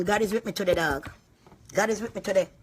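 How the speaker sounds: ordinary delivery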